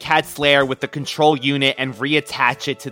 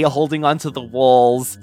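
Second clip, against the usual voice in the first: ordinary talk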